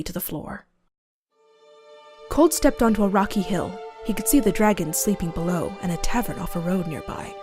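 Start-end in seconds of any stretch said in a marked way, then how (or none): none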